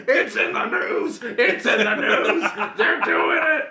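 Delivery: hammed-up high shouting rant, slowed; imitating a furious conspiracy host yelling; mock Alex Jones yell. Podcaster mimics Alex Jones losing it, high mock yell. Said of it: "He's going".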